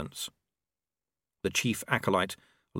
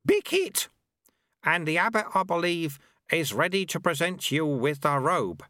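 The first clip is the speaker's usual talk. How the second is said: put-on a higher, sly old monk voice; old sweeper Lutze; 7 semitones higher than usual. A narrator's reading. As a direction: tease him like a cheeky old master. Lutze calling out.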